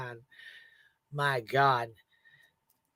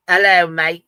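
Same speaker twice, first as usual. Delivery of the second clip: mock British accent; 2 semitones higher, 10 dB louder than usual. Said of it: "Mate is Australian".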